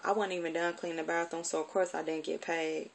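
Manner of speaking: ordinary talk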